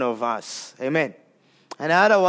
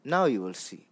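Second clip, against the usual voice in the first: low, soft and grave; soft promise; 10 semitones lower, 8 dB quieter than usual. Acted. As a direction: say it gently, like a vow. Echoes God's promise softly.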